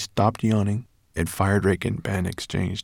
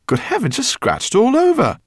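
fussing professor; 7 dB louder than usual. A narrator's reading. Professor fussing over Ben.

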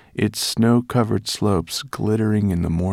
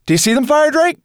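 put-on high, loud boyish outburst; loud, eager calling out; excited cry; 8 dB louder, faster than usual. A narrator's reading. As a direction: cry it out at the top of your pitch. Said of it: "cried Ben".

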